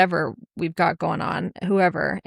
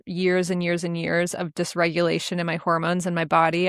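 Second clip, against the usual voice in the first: ordinary delivery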